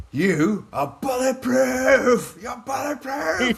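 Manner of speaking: slow, high-pitched drawn-out coach voice; coach hyping me up. Retold or imitated. Coach in the corner telling the fighter he's bulletproof, slow high hyped voice. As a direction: stretch it out like a coach pumping up his fighter. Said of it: "He goes".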